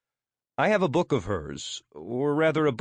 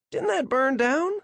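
high-pitched, dim American questioning; clueless American. Pretend. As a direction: play dumb with an American accent. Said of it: "Affecting my best clueless American tone".

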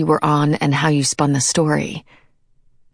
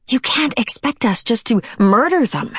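high-pitched protest; 7 semitones higher than usual. A narrator's reading. Girl argues with queen.

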